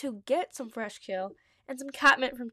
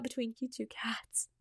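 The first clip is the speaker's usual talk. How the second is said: whispering; 12 dB quieter than usual. Acted. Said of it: "Pineclaw whispered".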